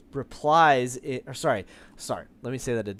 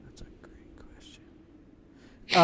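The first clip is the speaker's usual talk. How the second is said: whispered to himself, slow; whispered aside; 6 dB quieter, slower than usual. Real, not acted. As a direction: murmur while thinking. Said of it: "GM stalling".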